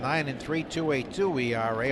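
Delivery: usual speaking voice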